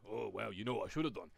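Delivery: low-pitched manager impression; Sean Dyche. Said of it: "that's my Dyche impression".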